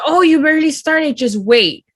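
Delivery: a playful imitation of his taunt; mock teasing. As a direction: tease like a smug friend. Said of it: "He goes".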